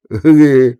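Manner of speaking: put-on short loud drunk laugh; drunken laugh. A narrator's reading. Kuzma laughs at Efrem, loud drunken laugh. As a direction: laugh out like a tipsy man. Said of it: "Засмеялся Кузьма".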